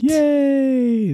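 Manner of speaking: high, bright squeal of joy; excited cheer. Real, not acted. Cheering weather update.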